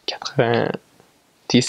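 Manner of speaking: whispered French quatre-vingt-dix, said slowly; French quatre-vingt-dix, half whispered; French number. Shown as a demonstration. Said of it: "so it's four twenty, ten".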